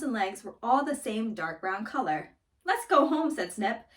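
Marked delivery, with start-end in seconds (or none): 2.6-3.3 put-on urgent childlike call. Boys fleeing before baker scolds.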